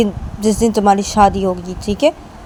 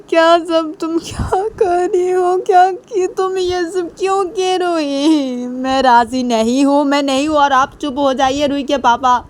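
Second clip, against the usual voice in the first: mock crying mother; 9 semitones higher than usual. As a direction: play a weeping mother refusing. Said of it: "Ruhi की mummy रोए जाती थी".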